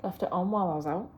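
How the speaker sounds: ordinary talk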